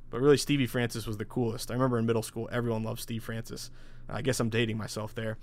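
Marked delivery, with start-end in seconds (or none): none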